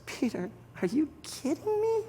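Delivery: very high, soft rising disbelief; incredulous. Acted. Jesus answers Peter's resignation on the beach, incredulous. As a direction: squeak up in amazement at the question.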